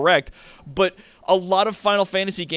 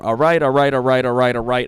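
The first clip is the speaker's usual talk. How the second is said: louder, hurried all rights to skip along; impatient all right; 3 semitones lower, 6 dB louder than usual. Retold or imitated. Mocking her own impatience with the game's long chatter, a louder all right. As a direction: brush the chatter aside.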